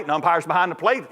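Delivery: ordinary talk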